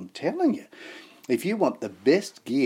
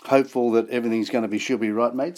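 ordinary talk

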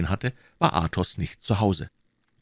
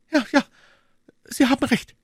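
put-on very high, startled young lady; alarmed high female character voice; frightened; 4 dB louder than usual. A narrator's reading. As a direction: agree in alarm, pitched right up. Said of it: "entgegnete Madame Bonacieux erschreckt".

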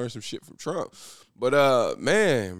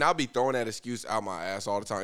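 ordinary talk, nothing marked

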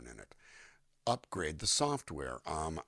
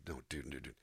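normal talking voice

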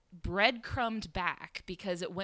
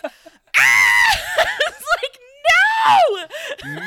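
high, mic-blowing shriek of dismay; hammed-up horror at full volume; horrified scream; 18 dB louder, slower than usual. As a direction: scream a long, anguished no. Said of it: "I just blew out my levels so bad".

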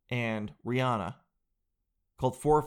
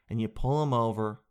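normal talking voice

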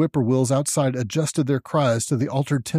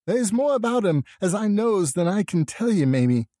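high-voiced rustic mother, fearful complaint; Lavinia; 7 semitones higher than usual. A narrator's reading. Poor mother complaining of her son, anxious rural voice. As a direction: confide a worry in raised country dialect. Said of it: "complained to Mamie Bishop of being afraid".

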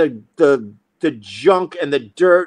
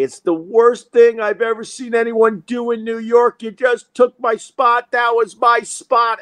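raised-pitch mock yell of outrage; mock screaming; 7 semitones higher than usual. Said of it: "This guy started screaming at me".

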